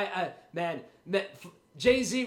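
ordinary talk, nothing marked

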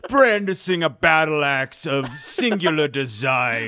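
slow, loud, hammed-up herald; mock epic announcer. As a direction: proclaim a legend with mock grandeur. Mock grand introduction of a hero.

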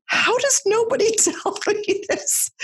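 high, slow, laughing disbelief; incredulous laughing question. Retold or imitated. Asking why nobody warned her, high laughing disbelief. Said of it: "I'm like".

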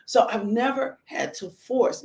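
ordinary talk, nothing marked

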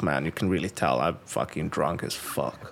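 low, quiet muttered reaction to oneself; dismayed inner thought. Retold or imitated. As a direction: drop the voice low and soft, imitating your own cringing thought. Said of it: "I'm like".

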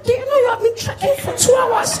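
high demon voice. Acted. Demon taunting the trekking comedian, high and soft.

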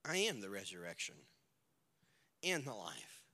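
low, quiet and grave; Jesus quietly declaring who he is; solemn declaration. Acted. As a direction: speak it with deep calm authority. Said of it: "you can almost hear the tone".

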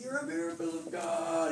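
imitated high, tearful wail; soft, high-pitched mock sobbing voice; weeping. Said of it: "He's, like, just weeping and weeping".

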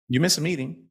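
ordinary talk, nothing marked

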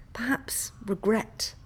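ordinary delivery, nothing marked

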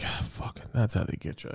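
whispered, resigned mock grumble; mumbled aside. To himself at the doctor's office, whispered grumble. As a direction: grumble it under your breath. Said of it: "I'm like".

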